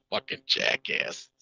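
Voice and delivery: slow, whispered insult under the breath; whispered aside. Real, not acted. Scoffing at the critics in chat, whispered insult. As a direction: mutter it to yourself. Muttered aside between laughs.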